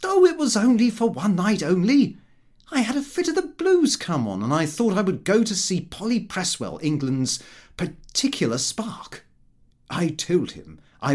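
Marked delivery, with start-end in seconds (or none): 0.0-9.3 put-on light careless young man; lightly voiced young Lupin. A narrator's reading. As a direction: toss it off casually. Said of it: "but he replied".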